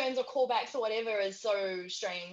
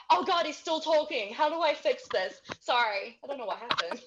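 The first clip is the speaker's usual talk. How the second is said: mock panic; 5 semitones higher, 4 dB louder than usual. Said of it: "I was like".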